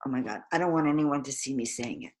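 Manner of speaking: restrained, embarrassed. Shown as a demonstration. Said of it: "a restrained way that may not bring you too much would go".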